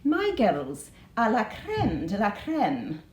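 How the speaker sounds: slow, careful attempt at an Edinburgh accent. Shown as a demonstration. Teacher quoting Miss Brodie for pupils, slow Edinburgh accent. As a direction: savour each word in a Scottish Edinburgh lilt. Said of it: "attempt to say this in an Edinburgh accent".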